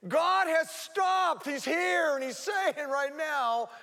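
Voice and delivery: high, loud, slow proclamation; loud preaching proclamation. Real, not acted. Preacher declaring to the church, loud and slow. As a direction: hammer it home slowly at the top of the voice.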